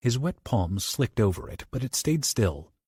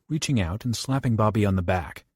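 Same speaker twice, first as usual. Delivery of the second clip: usual speaking voice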